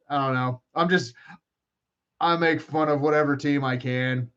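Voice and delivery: ordinary talk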